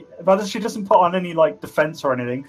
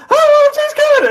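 high, loud shrieking scream, slowly drawn out; 9 dB louder, slower than usual. Acted. Frightened performer screaming as she flees into the woods. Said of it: "sort of screams and goes".

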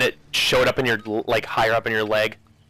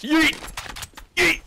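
excited yell. Real, not acted. Throwing a knife.

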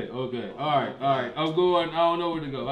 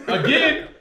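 ordinary talk, nothing marked

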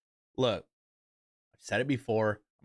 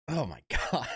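laughing through the words; 4 semitones higher, faster than usual. Real, not acted. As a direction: chuckle while saying it. Laughing at himself.

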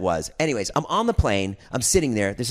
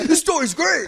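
laughing; 13 semitones higher, 5 dB louder than usual. Real, not acted. Laughing while talking.

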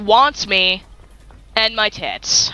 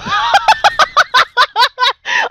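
long, high cackling laugh; shrieking fit of laughter; real laughter; 4 dB louder than usual. Real, not acted. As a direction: crack up at your own blunder. Cracks up at own failure.

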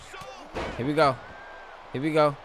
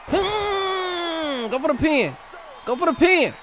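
high excited shout; 13 semitones higher, much slower than usual. Real, not acted. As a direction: push him to pin, shouting. Urging the pinfall.

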